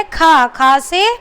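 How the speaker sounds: खा stretched slowly with a clear breathy kh; slow sound demo. Shown as a demonstration. Teacher asks what sound it makes, then slowly sounds out खा. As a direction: draw out खा so pupils hear the kh. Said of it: "किसकी आवाज़ निकल रही है".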